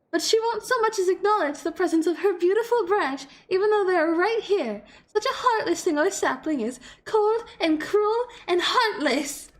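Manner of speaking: high, loud theatrical complaint; aggrieved fairy monarch. Acted. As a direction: wail it like a spurned royal, half-laughing. Voices game dialogue.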